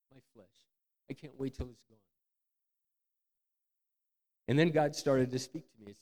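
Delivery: ordinary talk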